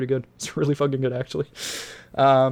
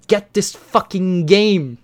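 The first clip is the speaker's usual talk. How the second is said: slow, emphatic, raised-pitch push; emphatic urging; 3 semitones higher, 4 dB louder, slower than usual. Real, not acted. Host urging listeners to buy the game, emphatic and excited. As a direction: press it on them word by word.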